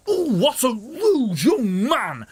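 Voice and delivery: put-on slow, high old-lady tut; prim granny scandalised; shocked granny. Granny at the back of the queue, slow high tut.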